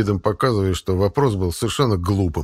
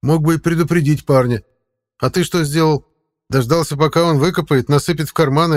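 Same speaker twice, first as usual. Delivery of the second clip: higher, louder voice for the talking cat; 7 semitones higher, 4 dB louder than usual. Acted. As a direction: play the sly cat guide. Angry cat.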